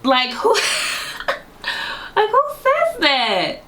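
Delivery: high squeal through laughter; giggly high outrage; laughing disbelief. Real, not acted.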